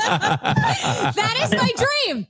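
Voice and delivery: laughing through a cheeky dream; giggling as she drags out her joke. Real, not acted. Sister joking to the family, laughing through it. Joking about dying during sex.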